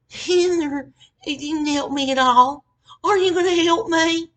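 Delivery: loud, high-pitched crybaby wail; mock crying. New girl tries crying to a coworker, mock tearful wail. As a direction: wail it pleadingly, making fun of her. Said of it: "she's trying to fucking cry to her".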